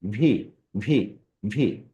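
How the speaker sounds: spaced repeats of ভি, each started on teeth and lip. Shown as a demonstration. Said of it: "ওপরের দাঁত এবং নিচের ঠোঁট".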